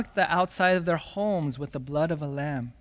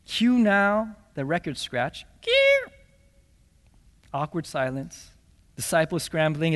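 usual speaking voice

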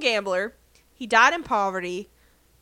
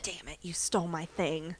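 "you stole my thing" in a voiceless hush; playful whispered grumble, very soft; whispered complaint; 4 semitones lower, 11 dB quieter than usual. Pretend.

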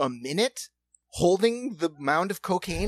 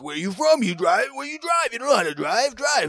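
louder, higher mock interrogation; exasperated rant; 7 semitones higher, 5 dB louder than usual. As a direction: imitate a frustrated driver firing questions. Acts out traffic rant.

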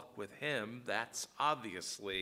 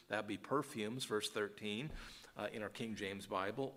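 usual speaking voice

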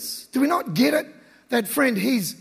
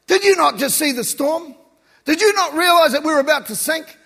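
higher, louder indignant protest; incredulous disciple; 6 semitones higher, 6 dB louder than usual. Acted. Disciple challenging Jesus after the storm, loud disbelief. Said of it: "imagine if you're one of the disciples".

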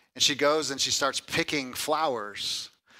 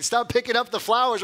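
mock yell; 9 semitones higher, 4 dB louder than usual. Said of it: "yelling at her and saying".

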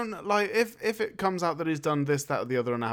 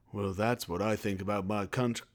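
low, soft, dreamy patriot; glassy-eyed reverie; 9 semitones lower, 5 dB quieter than usual. Acted. Film hero musing on his country, glassy-eyed. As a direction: drop low and hushed, as if gazing into the distance. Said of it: "he gets all glassy-eyed when he looks into".

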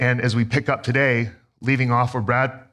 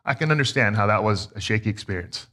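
softer and lower, gently reassuring a shaken daughter; tender; 6 semitones lower, 3 dB quieter than usual. Retold or imitated. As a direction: say it like a comforting dad, quietly. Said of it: "being tender with it".

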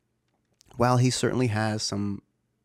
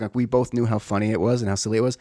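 usual speaking voice

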